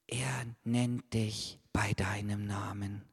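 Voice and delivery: intimate whisper. Acted. Preacher whispers to each child that God knows them. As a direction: whisper it close.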